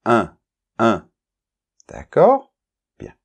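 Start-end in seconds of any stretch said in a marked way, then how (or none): 0.0-1.1 un said twice with the nasal vowel. Shown as a demonstration. Teacher models the nasal un for the learner. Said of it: "ça se prononce avec la nasale".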